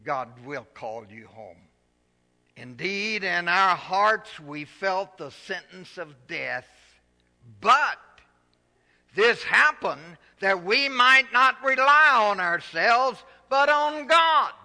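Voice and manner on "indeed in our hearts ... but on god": loud, high, slow scripture proclamation; full-force preaching, slow. Acted. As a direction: proclaim it slowly to the whole church.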